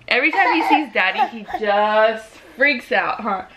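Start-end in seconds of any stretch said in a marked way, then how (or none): none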